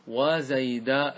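very slow وزيداء with a long open aa; alif at the end. Shown as a demonstration. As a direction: stretch وزيداء into "waa zaydaa". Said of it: "أن تأتي بدله بالألف في آخره".

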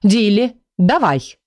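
understated call to action; mild shout. A narrator's reading. Cassandra cues Dilly to cast, mild shout. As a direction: call out the cue. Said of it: "и крикнула".

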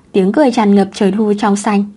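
plain reading voice

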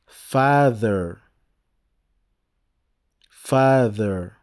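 slow, clear English "Father" with a falling tune. Shown as a demonstration. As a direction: say "Father" the English way, teacher-style. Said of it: "Écoutez bien et répétez après moi".

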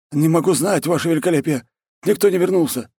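high-pitched distressed confession; panicked report. Acted. War chief confesses the lost raiders, high panicked voice. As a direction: blurt out bad news, high.

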